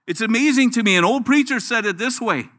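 ordinary delivery

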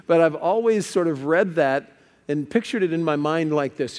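usual speaking voice

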